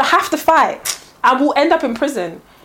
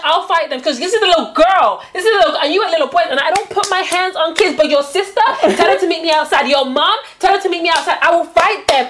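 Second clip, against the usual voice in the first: angry mum; 6 semitones higher than usual. Retold or imitated. Quoting angry mum at school.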